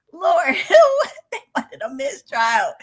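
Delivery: laughing through the words. Real, not acted. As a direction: crack up mid-sentence. Laughing at mistrial request.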